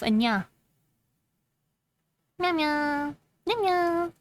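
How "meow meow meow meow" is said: higher, playful kitten meows; lightly voiced cat. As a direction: purr out little cat sounds. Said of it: "That's my language now".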